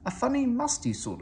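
high, cheeky little engine voice; young engine sniffing the air; Thomas the tank engine. A narrator's reading. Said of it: "said Thomas".

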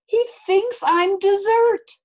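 put-on high, loud child's protest; high-pitched, alarmed storybook kid; frightened cry. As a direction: wail it in a little boy's panic. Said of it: "cried Eddie".

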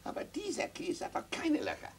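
put-on high whining little boy; a child's high voice nagging mama. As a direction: play a curious small boy, high and pleading. Child voice asking mother.

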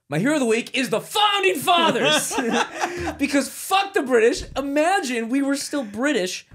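high, loud laughing shout. Real, not acted. To the podcast hosts, laughing loud declaration. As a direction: crack up while yelling it out.

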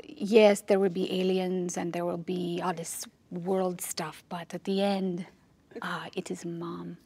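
put-on soft, fading French Canadian accent; a director sinking to a whisper in French Canadian accent; bad French Canadian accent. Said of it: "doing that bad accent".

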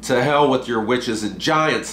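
ordinary delivery, nothing marked

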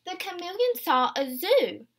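gentle picture-book read-aloud; storybook narration for little ones; reading to children. Acted. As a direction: read it aloud to a class of young kids. Teacher reads picture book aloud.

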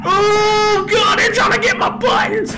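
panicked high shout; scared horror game streamer. Real, not acted. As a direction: scream it as they grab you. Being attacked.

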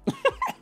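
quick high burst of a laugh; a brief amused laugh; short laugh. Real, not acted. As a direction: let a little laugh slip out. Laughing at quoted flop.

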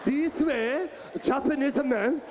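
mock Japanese accent with a forceful grunt; caricatured Japanese-accented delivery; Japanese man accent. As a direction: imitate a Japanese man, punching out the syllables. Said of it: "When a Japanese man talks".

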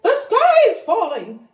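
high, loud alarmed cry. Acted. Henny Penny and Ducky Lucky crying the news to Turkey Lurkey. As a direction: shout the news. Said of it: "cried Henny Penny and Ducky Lucky".

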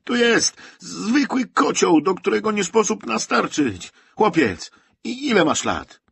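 furious high-pitched shout; raging officer. A narrator's reading. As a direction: bellow it at full force, like an officer losing his temper. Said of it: "pieklił się dalej podpułkownik".

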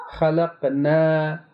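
खलकना slow, with a thin, light aa; fine Quranic alif. Shown as a demonstration. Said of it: "इसको बारीक पढ़ रहे हैं". (बारीक अलिफ से खलकना)

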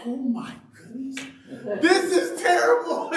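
high, rising to a loud laughing wail; hammed-up horror, breaking into a laugh; comic terrified recruit; mock panic. Scared recruit at barracks.

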